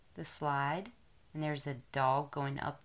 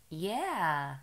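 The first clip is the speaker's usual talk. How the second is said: usual speaking voice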